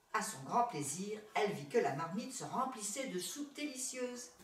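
ordinary delivery

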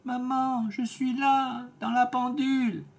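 put-on high, small little kid's voice; a tiny goat calling from hiding; tiny thin voice. Youngest kid calling his mother from the clock, tiny and high. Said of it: "une petite voix fluette".

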